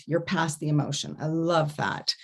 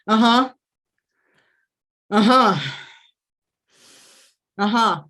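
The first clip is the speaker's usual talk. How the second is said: louder, pushed uh-huh grunts; loud huddle uh-huh; 3 semitones higher, 6 dB louder than usual. Retold or imitated. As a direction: grunt your agreement like a huddle. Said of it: "They're going".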